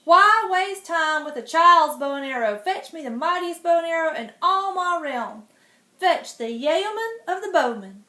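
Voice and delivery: loud, high commanding king; king shouting orders through the palace; ringing royal command. Acted. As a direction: boom it across the palace. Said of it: "The king's voice rang out".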